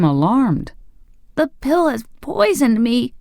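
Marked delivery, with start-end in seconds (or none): 1.3-3.1 gasped. A narrator's reading. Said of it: "he gasped".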